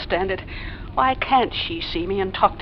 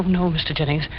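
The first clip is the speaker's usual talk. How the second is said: ordinary talk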